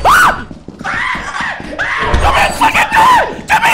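panicked scream. Real, not acted. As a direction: scream in terror at teammates. Attacked, screaming at teammates.

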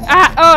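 loud startled scream; real alarm cry. Real, not acted. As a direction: yell out in alarm. Car crash scare.

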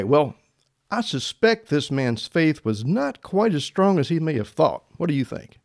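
usual speaking voice

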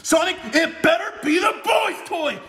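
mock angry demand. Knuckles demanding a boy's toy.

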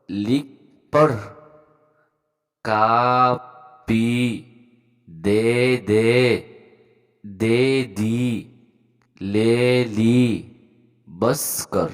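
loud, drawn-out word-by-word reading; pronunciation demo. Shown as a demonstration. Teacher reading Urdu words for beginners, loud pronunciation demo. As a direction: project each short word and hold the long vowels. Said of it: "আগে উচ্চারণটা দেখব".

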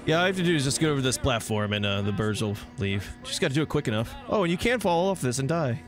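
normal talking voice

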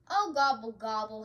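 mild turkey howl; loud "oh", then easing off; half-hearted storybook howl. A narrator's reading. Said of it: "howled, howled Turkey".

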